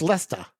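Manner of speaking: Leicester as two syllables, LES-tah; Boston-style Leicester, dropping the final R; Boston pronunciation. Shown as a demonstration. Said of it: "gotta have that Boston accent".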